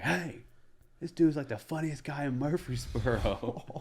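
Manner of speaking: loud, high heckle-back; retold yelling. Buddy defends comic to the heckler, retold yelling. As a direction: yell it across the room in defence. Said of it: "just yelling".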